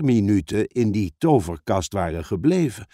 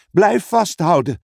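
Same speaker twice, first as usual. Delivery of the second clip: higher, insistent command; urgent call; 6 semitones higher, 3 dB louder than usual. Acted. Suze urging the others to keep holding, calling out. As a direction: call out to hang on. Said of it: "riep Suze".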